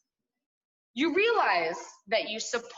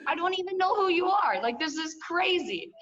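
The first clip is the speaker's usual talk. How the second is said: exasperated; 8 semitones higher than usual. Real, not acted. Venting disbelief at her mother.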